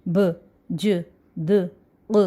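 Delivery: "ب، ج، د، ق" as short isolated consonant sounds; bare sounds of "ب، ج، د، ق", each separated by a pause; letter articulation points. Shown as a demonstration. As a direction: sound out "ب، ج، د، ق" singly, not by letter name. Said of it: "یہ اس کے مخرج ہیں".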